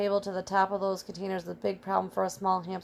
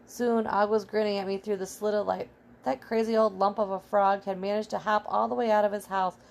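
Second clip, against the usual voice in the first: ordinary delivery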